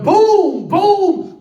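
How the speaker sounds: huge, high shouted booms; mock cannon blast. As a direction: blast out each boom like cannon fire. Imitating cannon fire.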